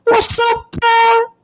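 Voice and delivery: squeaky high put-on voice; mock them in a shrill cartoon voice; taunting sing-song greeting to a rival; mocking falsetto greeting.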